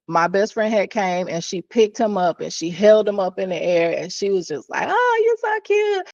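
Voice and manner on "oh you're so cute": put-on high, sing-song coo at a toddler; cooing baby talk. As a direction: coo like you're holding a baby up.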